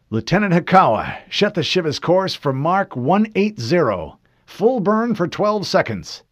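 firm, loud bridge command; commanding officer. Acted. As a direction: give crisp military orders. Commander barks launch orders.

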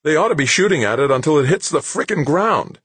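high, heated outburst; demanding. A narrator's reading. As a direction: insist loudly, pitched well up. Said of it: "Anson demanded".

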